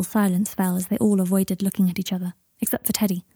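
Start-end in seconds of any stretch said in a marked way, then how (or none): none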